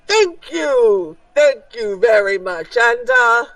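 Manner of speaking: master of ceremonies calling out thanks; showman ringmaster. Acted. Ringmaster taking back the microphone, showy thanks.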